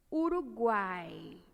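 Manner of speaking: Uruguai said very slowly, stressing the A; slow demo word. Shown as a demonstration. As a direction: draw out Uruguai to show the vowel A. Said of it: "a vogal em destaque".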